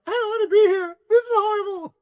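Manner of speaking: high, whiny mock sob; mock crying. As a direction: whimper like a scared kid on a ride. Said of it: "I'm, like, crying".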